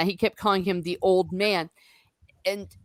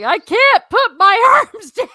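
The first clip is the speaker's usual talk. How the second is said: put-on much higher, louder, slow kid's whine; much higher, louder laughing whine; stuffed-up kid; 12 semitones higher, 12 dB louder than usual. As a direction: whine like a bundled-up little brother. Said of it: "he's like".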